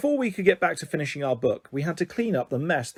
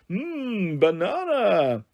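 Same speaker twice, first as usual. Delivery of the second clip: gorilla savouring food; 5 semitones higher, much slower than usual. A narrator's reading. Gorilla savouring banana's scent.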